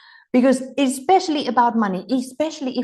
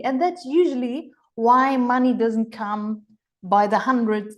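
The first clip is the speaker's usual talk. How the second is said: normal talking voice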